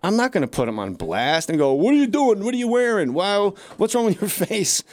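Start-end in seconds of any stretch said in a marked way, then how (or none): 1.7-4.8 mock heckling. Comic mimicking mean crowd work.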